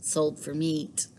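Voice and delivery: slow, soft heavy words; quiet sad repeat. Acted. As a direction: let the words sink slowly.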